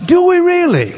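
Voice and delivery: high, loud, incredulous question; pointed challenge. Real, not acted. Preacher challenging his listeners, high and loud.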